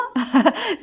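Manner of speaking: high burst of laughter; laughing. Acted. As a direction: burst out laughing at your friend. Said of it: "الضحكة سمعت في البيت كله".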